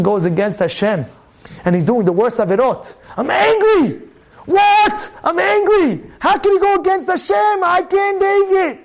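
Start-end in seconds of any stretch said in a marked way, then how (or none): 3.2-8.8 high, impassioned outrage; acted zealous fury; righteous anger. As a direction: cry out indignantly, stretching the last words. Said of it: "what's good anger?".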